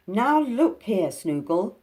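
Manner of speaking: high, loud and slow confrontation; bold. Acted. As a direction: square up to him. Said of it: "said Binky boldly".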